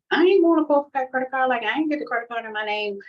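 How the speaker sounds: high, loud frantic protest; panicked refusal. Retold or imitated. She refuses to go to court, high and panicky.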